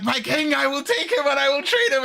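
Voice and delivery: high, breakneck-urgency proclamation; urgent declaration. Retold or imitated. General claiming her trainee from the king, high urgent declaration. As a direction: proclaim it with mock zeal, pitched well up.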